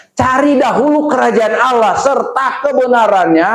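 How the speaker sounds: high, emphatic sermon delivery; forceful preaching. Real, not acted. Preacher quoting scripture to the congregation, high and forceful. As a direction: drive the verse home to the congregation.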